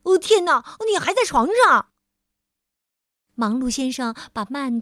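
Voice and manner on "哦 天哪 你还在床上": much higher, louder and fast; exclaiming. Acted. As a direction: gasp it out in shock. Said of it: "他惊呼起来".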